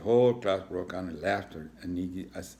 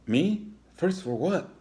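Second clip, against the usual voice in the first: put-on high, loud startled kid; startled lizard; 10 semitones higher, 5 dB louder, slower than usual. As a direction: caught off guard, alarmed. Iggy startled.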